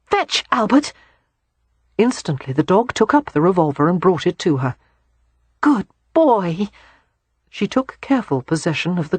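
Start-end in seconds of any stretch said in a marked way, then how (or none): 0.1-0.9 brisk command to dog. Acted. 5.6-6.7 praising dog. Acted.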